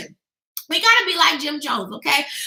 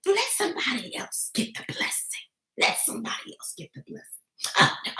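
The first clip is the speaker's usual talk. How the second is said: whispered, self-effacing aside; mock humble whisper; 3 semitones lower, 11 dB quieter than usual. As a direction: whisper it as if shrinking from the blessing. Mocking falsely humble believer.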